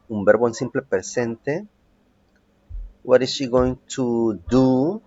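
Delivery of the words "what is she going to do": slow modelled question. Shown as a demonstration. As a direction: say it slowly so students follow. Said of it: "teacher models the question". (pregunta modelo, lenta)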